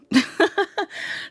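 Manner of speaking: high burst of giggling; cracking up. Real, not acted. Card reader laughing at her mispronunciation, cracking up. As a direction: laugh helplessly at your own slip. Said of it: "I'm cracking up".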